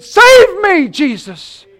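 sinking Peter yelling to Jesus; panicked shout. Acted. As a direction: scream it while going under. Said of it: "He shouted".

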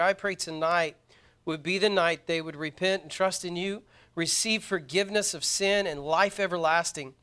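earnest prayer. Real, not acted. Preacher praying for the young people, earnest prayer. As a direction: lead the room in prayer, low and even.

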